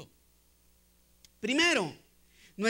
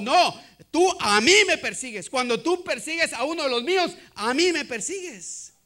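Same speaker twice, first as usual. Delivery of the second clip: loud accusation, leaning on "a mí"; emphatic accusation; 4 semitones higher, 6 dB louder than usual. Acted. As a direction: drive home "a mí" as the one wronged. Acts Jesus confronting Saul forcefully. (acusación enfática, con fuerza en "a mí")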